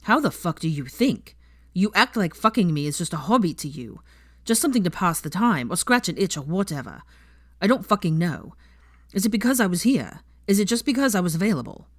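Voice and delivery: loud angry accusation. Acted. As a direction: hurl the questions angrily. Draco furious, swearing.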